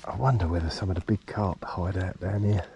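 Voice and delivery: ordinary talk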